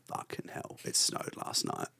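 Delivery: near-whispered awe, voiceless and soft; retold whisper of amazement at snow; hushed disbelief. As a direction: breathe it out in stunned wonder. Retelling first sight of snow.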